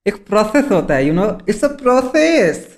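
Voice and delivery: slow, high self-important drawl; pompous. Acted. Lawyer lecturing the host smugly. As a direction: stretch each word, smug.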